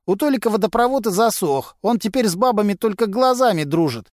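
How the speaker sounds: a sly garage lowlife gossiping to cops; Vasily, a gruff mechanic. A narrator's reading.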